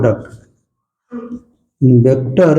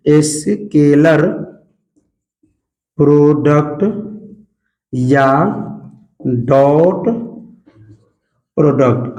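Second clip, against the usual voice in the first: Scalar product या डॉट product spelled out very slowly, long pauses between words, lower; slow dictation; 4 semitones lower, slower than usual. Shown as a demonstration. Teacher dictating the term to his class, very slow and lower. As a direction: dictate each word slowly for notes. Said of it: "तो इसी को हम लोग कहते हैं".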